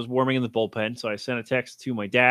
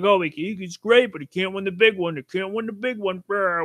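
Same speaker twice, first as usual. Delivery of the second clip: higher-pitched whiny parody of critics; mocking the naysayers; 6 semitones higher than usual. Sports host mimics critics of a star player, mocking naysayer voice. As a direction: parrot the doubters in a silly raised voice. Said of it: "you know the narrative".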